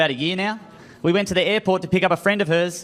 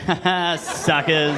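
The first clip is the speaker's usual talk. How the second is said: smug laugh; much slower than usual. Retold or imitated. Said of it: "you just go".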